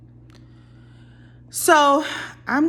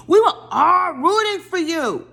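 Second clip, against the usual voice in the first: loud, high mock scolding; exasperated outburst; 10 semitones higher, 5 dB louder than usual. Viewer scolding a castmate she supported, exasperated outburst. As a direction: cry it out in exaggerated disappointment.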